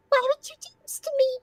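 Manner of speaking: very high, fast hurt pony plea; wounded pony voice. Acted. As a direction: cry it out as a cut cartoon pony.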